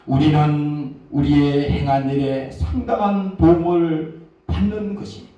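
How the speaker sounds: slow, weighty penitent thief; solemn confession. Acted. Penitent thief confessing to the other, slow and solemn. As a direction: lay out the guilt slowly. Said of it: "막 그러고 또 얘기합니다".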